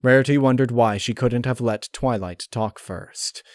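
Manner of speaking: usual speaking voice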